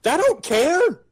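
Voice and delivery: high, loud angry yell; furious shout. Acted. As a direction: scream it in rage. Furious in-character outburst.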